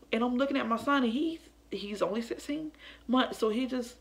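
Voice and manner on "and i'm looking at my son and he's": normal talking voice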